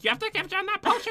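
high-pitched witchy rasp; witch voice. Acted. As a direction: squeal it like a witch. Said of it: "I sound like a witch".

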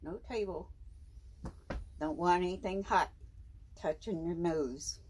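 ordinary delivery

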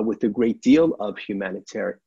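normal talking voice

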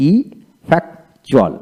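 slow ইফেক্টুয়াল in separate pieces; broken into syllables. Shown as a demonstration. Teacher breaking ইফেক্টুয়াল down for learners, slow with gaps. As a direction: pull ইফেক্টুয়াল apart, syllable by syllable. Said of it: "এইভাবে ভেঙে ভেঙে".

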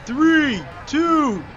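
high, loud count; excited countdown. Real, not acted. Player counts down to the throw, loud countdown. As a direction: call out each number loudly.

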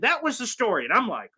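ordinary talk, nothing marked

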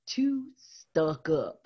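ordinary delivery, nothing marked

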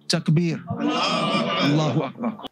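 slow, happy call with a laugh; joyful takbir. Real, not acted. Celebration after Shahada declared.

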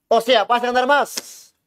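ordinary talk

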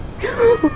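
huge high gasping cry; sharp gasp. Real, not acted. Player jump-scared by the animatronic, high gasp.

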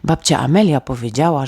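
ordinary delivery

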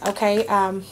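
ordinary talk, nothing marked

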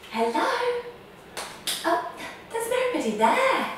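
a high playful radio hello; playful surprise. Acted. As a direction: call out, then find nobody there. Pretend answering radio.

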